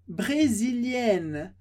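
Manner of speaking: "brésilienne" drawn out slowly, final -enne sounded; slow French word. Shown as a demonstration. Teacher slows down for students, stretched and clear. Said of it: "teacher repeats word slowly".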